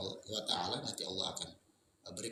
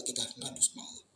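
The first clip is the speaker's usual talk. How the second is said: ordinary delivery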